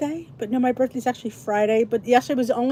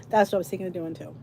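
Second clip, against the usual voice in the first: ordinary talk, nothing marked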